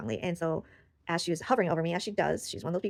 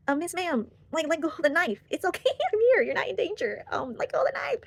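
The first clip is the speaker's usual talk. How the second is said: very high, pleading voice with a laugh; comic frantic coaxing; 10 semitones higher, 5 dB louder than usual. Retold or imitated. Woman coaxing her child self off a knife, high pleading. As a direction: coax urgently in a hammed-up high pitch.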